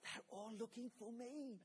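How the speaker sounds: soft whisper with a giggle in it; whispered chuckle. Retold or imitated. Hiding child whispering to the caller, chuckling. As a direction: whisper it, barely holding back a laugh. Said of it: "a whispered chuckle".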